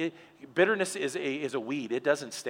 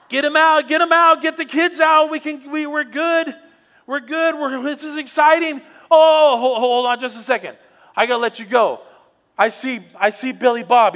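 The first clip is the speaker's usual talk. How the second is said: high, loud excited shouting, slowing on the orders; shouting with joy; 11 semitones higher, 15 dB louder than usual. Acted. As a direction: yell the good news down the phone. Husband shouting on phone.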